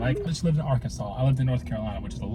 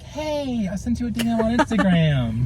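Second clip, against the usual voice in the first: high chirpy quote; 8 semitones higher, 3 dB louder, slower than usual. Retold or imitated. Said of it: "I'm like".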